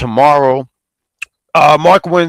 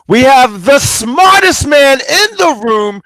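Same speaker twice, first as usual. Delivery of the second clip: hype intro; 9 semitones higher, 5 dB louder, much slower than usual. Acted. Host hyping up a co-host intro.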